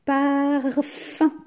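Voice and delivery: parfum stretched high and loud, par held before fum; syllable dictation. Shown as a demonstration. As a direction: draw out both syllables of parfum. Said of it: "Combien de syllabes?".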